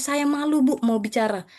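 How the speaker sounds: ordinary talk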